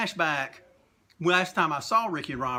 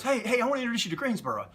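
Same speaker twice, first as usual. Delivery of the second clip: high, rapid imitation; imitating an eager wrestler calling someone over; eager introduction; 8 semitones higher, faster than usual. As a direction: rush out an introduction, pitched up.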